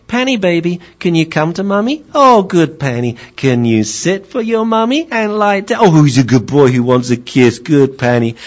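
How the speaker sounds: put-on high, cooing baby talk to a dog; doting wife. Wife calling her puppy to Mummy, doting baby talk. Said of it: "the wife would call and she'd say".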